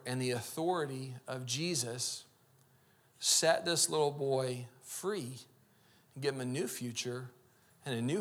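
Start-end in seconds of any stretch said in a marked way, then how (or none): none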